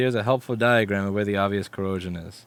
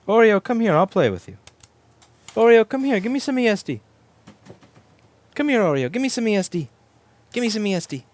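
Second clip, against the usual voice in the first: much higher and louder, calling the kitty; high cat-calling voice; 13 semitones higher, 8 dB louder than usual. Real, not acted. Owner calling cat Oreo over, high and loud. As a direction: sing out to the cat to come.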